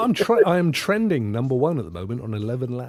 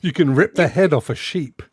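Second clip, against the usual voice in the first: usual speaking voice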